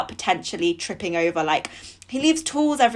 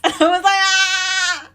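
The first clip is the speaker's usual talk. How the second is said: panicked scream; 10 semitones higher, 5 dB louder, slower than usual. Retold or imitated. Panicked reply to boss.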